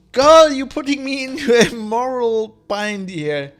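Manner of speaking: loud, high mock complaint; hammed-up exasperation; playful protest. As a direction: plead with her at full volume. Addresses game character, playfully torn.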